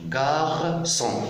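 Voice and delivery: "Garçon" high, split into "gar" and "son"; two separate syllables. Shown as a demonstration. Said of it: "Vous avez deux syllabes". (« Garçon » en deux syllabes, « gar » puis « son »)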